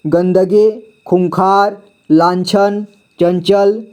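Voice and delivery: ordinary delivery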